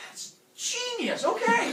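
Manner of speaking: very high, loud, hammed-up enthusiasm; mock delight. Zeus agrees to the axe cure, high over-the-top excitement. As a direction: draw out the first words, then burst out.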